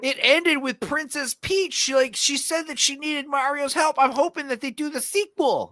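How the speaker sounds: high, hammed-up fanboy voice; mocking a fan. Imitating a friend who loved the movie, high and gushing. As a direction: gush like a naive kid fan. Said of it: "your friend really liked it".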